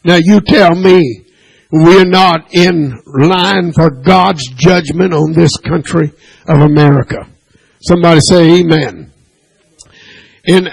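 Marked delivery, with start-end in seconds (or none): none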